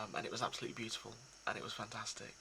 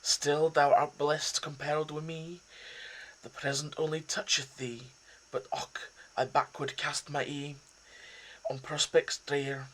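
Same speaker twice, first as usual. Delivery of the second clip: raised, loud Scottish recital of Burns; Scottish accent; 4 semitones higher, 7 dB louder than usual. Acted. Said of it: "throw in, uh, a Scottish accent".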